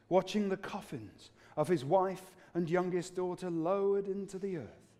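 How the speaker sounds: ordinary delivery, nothing marked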